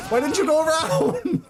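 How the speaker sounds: laughing disbelief. Real, not acted. Gamer teasing teammate Diva, high laughing outcry. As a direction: squeal it in laughing exasperation.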